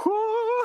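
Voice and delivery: high whooping sound; whoo. Acted. Acting out lifting out of the casket, high whoo.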